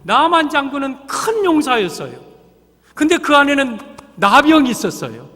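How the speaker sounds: impassioned sermon climax; fervent preaching. Acted. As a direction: press the congregation with each burden. Preaching at full force.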